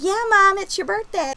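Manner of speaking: put-on high, light kid's cheer; imitated cheering child. Child egging on dancing mom, high imitated cheer.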